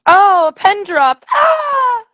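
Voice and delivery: high, loud mock panic over a pen; panicked scream. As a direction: shriek in exaggerated terror. Acting out fear of gravity.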